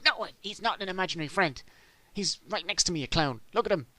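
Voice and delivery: high frustrated bird to doubters; high indignant Big Bird. Retold or imitated. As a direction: squawk it up high like a wronged bird. Big Bird voiced insisting.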